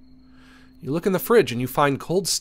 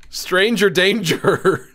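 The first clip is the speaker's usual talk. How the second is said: high, drawn-out playful warning; mock alarm; 5 semitones higher, 4 dB louder, much slower than usual. Player reacting to a stranger at the door, mock alarm. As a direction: stretch it out like a hammed-up alarm.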